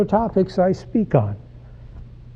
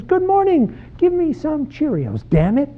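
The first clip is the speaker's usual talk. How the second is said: put-on bright, smiley six-year-old; 12 semitones higher than usual. Six-year-old trying out a swear word on Mom, bright and smiley. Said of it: "all bright and smiley, the six-year-old says".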